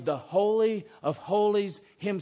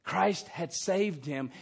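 normal talking voice

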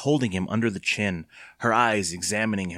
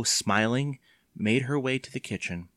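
plain reading voice

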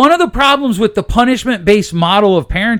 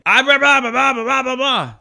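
mock parental rant; 7 semitones higher than usual.

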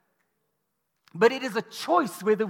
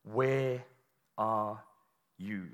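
tender call; 10 semitones lower, 7 dB quieter, much slower than usual. Acted. God calling out in the garden.

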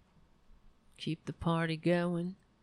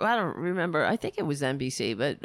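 ordinary talk, nothing marked

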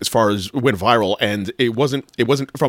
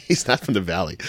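ordinary delivery

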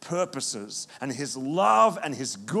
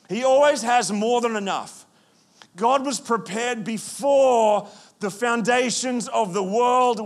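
louder, higher preaching voice, pressing each point; full-force preaching; 8 semitones higher, 6 dB louder than usual. Acted. Pastor stirring the congregation, preaching at full force. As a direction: drive it home from the pulpit.

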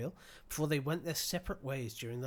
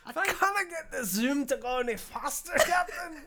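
put-on high, loud Irish accent through laughter; 9 semitones higher, 6 dB louder than usual. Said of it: "He's basically Irish, right?".

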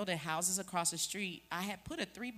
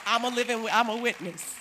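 loud testimony; 4 semitones higher, 11 dB louder than usual. Real, not acted. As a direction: proclaim it, loud and sure. Preacher testifies at full force.

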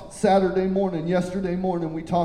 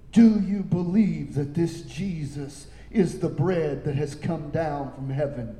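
ordinary talk

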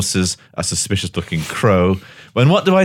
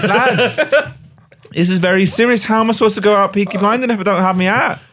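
much higher, fast, whiny protest; 11 semitones higher than usual. Retold or imitated. To his fellow gang members, protesting in a high squeaky voice. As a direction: squeak out the complaint like a wounded lad. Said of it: "And I'm like".

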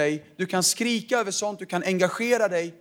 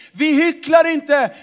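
full-force preaching; 9 semitones higher, 6 dB louder than usual. Acted. Pastor thundering to the church about true worship. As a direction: proclaim it as a rousing climax.

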